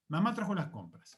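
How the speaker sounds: "Mamá trajo las compras" affirmed, tune going down at the end; falling statement intonation. Shown as a demonstration. Teacher affirming that mum brought the shopping, falling intonation. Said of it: "Cuando la entonación desciende".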